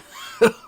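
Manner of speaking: high laugh. Real, not acted. Laugh after spicy sip.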